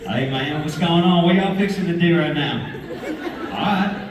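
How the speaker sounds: friendly Texas drawl with a chuckle. Shown as a demonstration. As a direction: greet a buddy in a Texas drawl. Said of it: "Texas, like, drawl, you know?".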